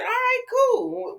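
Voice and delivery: high, drawn-out imitation of giving in; exaggerated, slow high-pitched okay; mock agreement. Retold grudging agreement ending the quarrel.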